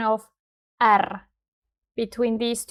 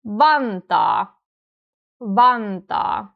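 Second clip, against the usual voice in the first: "Vantaa" with VAN strong, falling after; front-stressed Finnish "Vantaa"; stress on first syllable; slower than usual. Shown as a demonstration. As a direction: hit "Van" and let "taa" drop. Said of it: "This is the same for".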